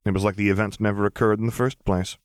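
ordinary delivery

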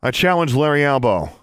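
high, loud challenge; ringing public challenge, pitched high; called out challenge. A narrator's reading. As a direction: call it out for all to hear. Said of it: "stepped up and called out".

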